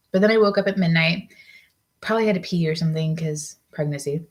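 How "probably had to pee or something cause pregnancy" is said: ordinary talk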